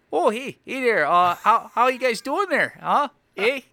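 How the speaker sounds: high, hammed-up Canadian accent. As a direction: chirp a friendly caricature Canadian greeting. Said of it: "I've done the Canadian accent".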